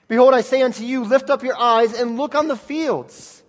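loud, high, stretched-out preaching; preaching at full force. Acted. Preacher proclaiming Jesus's words to the disciples, loud and high. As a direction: proclaim the verse like a call. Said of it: "preaching at full force".

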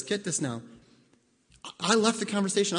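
ordinary delivery

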